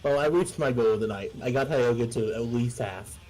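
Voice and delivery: ordinary delivery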